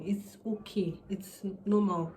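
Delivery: ordinary talk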